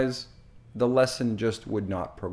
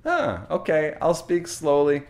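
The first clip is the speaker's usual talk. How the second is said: higher, mildly hammed-up compliance; agreeing; 5 semitones higher, 4 dB louder than usual. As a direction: imitate a relative readily agreeing. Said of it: "And the third time".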